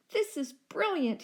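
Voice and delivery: excited shout. A narrator's reading. Rex shouting over his new idea, high and gleeful. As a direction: crow with triumph. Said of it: "he shouted".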